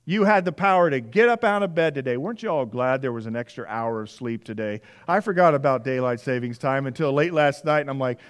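ordinary delivery

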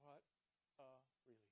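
usual speaking voice

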